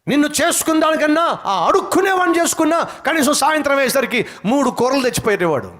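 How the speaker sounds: high, sharp mocking taunt of a nagging wife; mock scolding wife. Preacher voices a wife scorning her husband's salary, mock taunt. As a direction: jeer like a wife belittling his pay.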